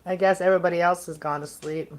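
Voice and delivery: usual speaking voice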